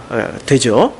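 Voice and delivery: ordinary talk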